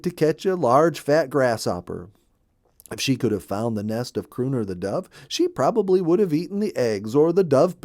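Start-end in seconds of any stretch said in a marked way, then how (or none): none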